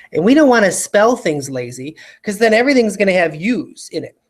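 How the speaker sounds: ordinary talk, nothing marked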